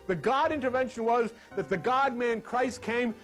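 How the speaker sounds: usual speaking voice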